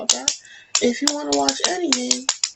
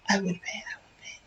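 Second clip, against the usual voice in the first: whispering; 4 semitones lower, 10 dB quieter, faster than usual. Real, not acted. Joking aside after laughing.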